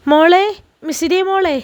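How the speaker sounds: put-on anxious mother calling her little girl; faltering call. A narrator's reading. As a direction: call out with a catch in the voice. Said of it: "ഇടറിയ ശബ്ദത്തോടെ വിളിച്ചു".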